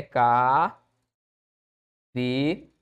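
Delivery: "K, V" as drawn-out ca, pause, fe; syllable split. Shown as a demonstration. Teacher dividing cafeína, "K, V" as slow separate syllables. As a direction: hold each syllable of "K, V" separately. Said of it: "a separação de cafeína é".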